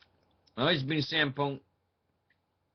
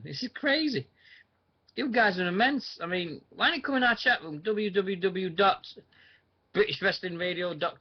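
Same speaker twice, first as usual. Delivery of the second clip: excited; 6 semitones higher than usual. Real, not acted. Surprised ten listeners still there.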